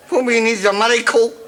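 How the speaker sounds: rushed cry for a miracle; desperate exclamation. Acted. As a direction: blurt it out in desperation. Desperate outburst.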